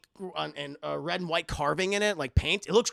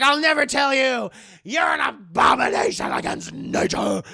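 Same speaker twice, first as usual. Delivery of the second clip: loud, raised, theatrical refusal; defiant mock shout; 4 semitones higher, 8 dB louder than usual. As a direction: denounce him in a hammed-up yell. John defiantly refuses Chucky.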